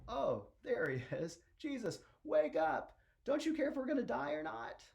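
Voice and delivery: high, panicked plea rising to a squeal; hammed-up frantic shout to wake someone; freaking out. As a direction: build from alarm to high-pitched panic. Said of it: "they're freaking out".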